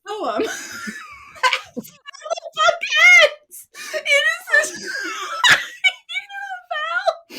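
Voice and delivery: high, breaking, laughing through the words; can barely get it out for laughing; helpless laughter. Real, not acted. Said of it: "edit out all my laughter here".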